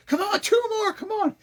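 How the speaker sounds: high, pushing gym-partner call; pushy gym coach. Retold or imitated. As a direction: egg him on through the last reps. Coach urging on a bench set.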